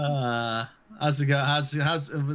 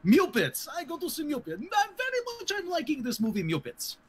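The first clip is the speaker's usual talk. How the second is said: hammed-up Russian comrade reviewing the Muppets, high and broken English; joking Russian accent reviewing Muppets for the podcast; mock Russian accent; 6 semitones higher than usual. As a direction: play a cartoon Soviet film critic. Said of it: "work on your Russian accents".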